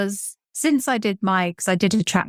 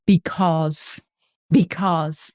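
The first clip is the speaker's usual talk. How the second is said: imitating his English because; much slower than usual. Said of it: "he says".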